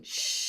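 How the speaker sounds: steady hushing exhale; long shh. Shown as a demonstration. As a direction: let the air out on a long shh. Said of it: "go out in that shh".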